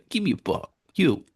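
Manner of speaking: high-pitched mock brat; bratty prick. Co-host joining in on the spoiled villain bit, bratty demand. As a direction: play at bossing someone around in a high voice. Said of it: "he's such a prick".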